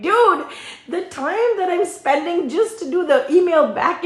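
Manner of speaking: high, exasperated rant. Retold or imitated. Vlogger venting at the customer, high and exasperated. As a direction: vent at her, half laughing.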